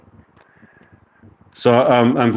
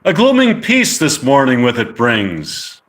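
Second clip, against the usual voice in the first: dramatic Shakespeare recital; 3 semitones higher than usual. Acted. Streamer reciting the end of Romeo and Juliet to listeners, dramatic declamation. Recites the closing lines of a play.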